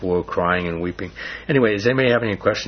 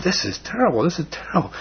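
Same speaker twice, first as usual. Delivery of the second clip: pitched-up despairing moan; 8 semitones higher than usual. Retold or imitated. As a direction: wail it like a let-down writer. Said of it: "And I'm saying".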